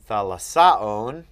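thalassaon very slow and loud, SA stressed; stress shifted late. Shown as a demonstration. Teacher reciting a declension, late stress on thalassaon. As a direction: stretch out thalassaon, leaning on the SA. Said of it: "with that accent".

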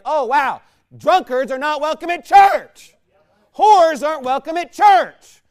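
shouting it out, loud and high; a booming mock epiphany; sudden loud shout of realization. Preacher acting a wake-up cry.

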